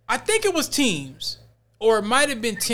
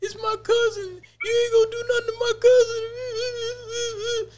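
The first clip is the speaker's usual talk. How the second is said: put-on distraught girl crying; slower than usual. Retelling the girl in the hallway, high crying wail. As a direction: wail it like a girl losing it over her cousin. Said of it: "She was like".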